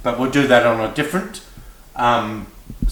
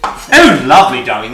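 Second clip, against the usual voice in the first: mock delight; 10 dB louder than usual. Mock delight at friend's hotel.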